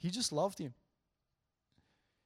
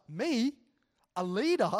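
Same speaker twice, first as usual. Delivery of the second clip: higher, louder astonished questions; imitated happy disbelief; 6 semitones higher, 6 dB louder than usual. Student hearing her first compliment, imitated delighted surprise. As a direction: act out a student lighting up in disbelief. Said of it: "her face just lit up".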